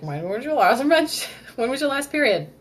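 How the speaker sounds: high, loud clinical doctor question, laughing mid-line; doctor grilling a patient about her period, high and loud; mock doctor. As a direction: ask like a brisk doctor at a checkup, breaking into a laugh. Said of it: "when you go to the doctor and they're like".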